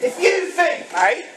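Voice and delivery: real rage at the man he blames, yelling him down; furious shouting. Real, not acted. Confronting his dad over asbestos, furious shouting. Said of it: "I went in mental".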